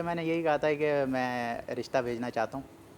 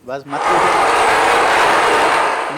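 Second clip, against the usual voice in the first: drawn-out exclaim; 13 dB louder, much slower than usual. Real, not acted. Dodging embarrassing cost question.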